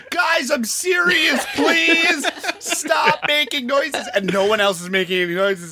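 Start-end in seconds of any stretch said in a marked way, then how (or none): 0.1-4.1 mock exasperated plea, high and drawn out; Samantha. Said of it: "continuing of her being like".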